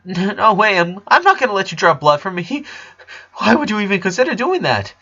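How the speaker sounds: backing away in alarm, voice up; frightened refusal. Acted. Lincoln refuses the blood draw.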